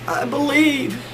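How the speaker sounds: high-pitched mock-tearful voice; shaking, tearful. Sick wife holding holy water, high tearful imitation. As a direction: imitate a sobbing woman, very high and weak. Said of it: "she's just a shaking".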